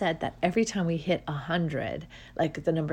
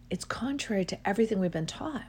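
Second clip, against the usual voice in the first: whisper; 2 semitones higher, faster than usual. Real, not acted. Stressing a hidden truth.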